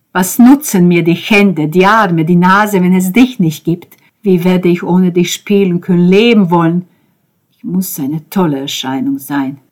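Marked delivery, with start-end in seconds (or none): 0.1-6.9 loud, despairing questions; raised voice, urgent lament; anguished plea. A narrator's reading. Anguished address to comatose beloved.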